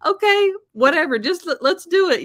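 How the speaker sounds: high, loud, breezy "okay, whatever" tone; mock resigned shrug. Telling her hairdresser to just go ahead, in a high, loud, mock-resigned voice. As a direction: toss it off like giving in to the hairdresser.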